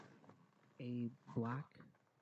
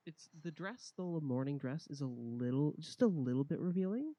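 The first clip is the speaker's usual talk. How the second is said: teasing; 4 semitones higher, 7 dB louder than usual. A narrator's reading. GM teasing description of dress.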